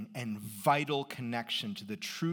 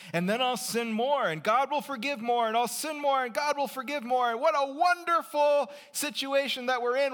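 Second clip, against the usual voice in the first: building from plain to much higher, louder glee; mock gleeful sinner; 10 semitones higher, 5 dB louder than usual. Preacher mocking the objector, building to giddy glee. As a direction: egg on the objector's logic until it's giddy. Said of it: "isn't that just going to make you say".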